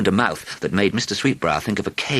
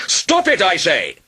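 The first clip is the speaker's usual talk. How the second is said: much higher, louder dragon yell; 13 semitones higher, 5 dB louder, slower than usual. A narrator's reading. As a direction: yell at the archer to stop. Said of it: "the dragon yelled".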